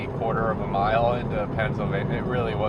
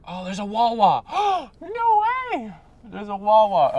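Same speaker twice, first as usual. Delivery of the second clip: high, loud thrilled outburst with a gasp; overjoyed at a sudden lucky sighting; real excitement; 12 semitones higher, 5 dB louder than usual. Real, not acted. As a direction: gasp and cry out in delighted disbelief. Spots a Wawa while driving.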